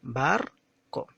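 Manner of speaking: "Barco" as two beats, the first stronger; stress on first syllable. Shown as a demonstration. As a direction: hit the "Bar" of "Barco", pause, then a lighter "co". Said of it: "la primera ha sido pronunciada con mayor tonalidad".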